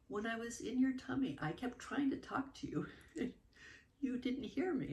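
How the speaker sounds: put-on high, earnest child's voice; mother doing her toddler's voice, high and serious. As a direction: play the little girl explaining herself.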